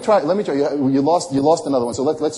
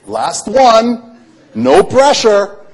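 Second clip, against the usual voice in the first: loud, high, drawn-out announcement; a showman teasing the pressure, slow and loud; mock dramatic suspense; 9 semitones higher, 8 dB louder, much slower than usual. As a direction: ham up the big finale for the crowd. Magician builds mock suspense.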